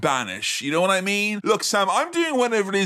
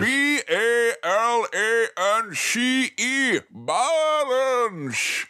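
sensei voice; 7 semitones higher, much slower than usual. Retold or imitated. Mimicking a karate sensei.

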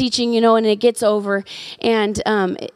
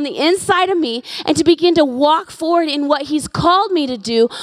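raised, driving sermon voice; preaching; 5 semitones higher than usual. Acted. Pastor rallying the church to act, preaching at full force.

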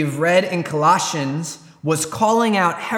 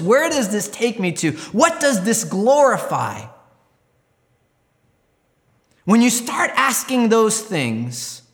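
raised, higher-pitched preaching with punched questions; emphatic preaching; 4 semitones higher than usual. Real, not acted. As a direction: press the congregation hard, pitch lifted. Preacher presses the church to question.

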